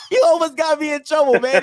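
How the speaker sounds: high-pitched, cracking up mid-sentence; real laughter breaking through a high, teasing complaint; laughing protest. Real, not acted. Laughing over near trouble with girlfriend.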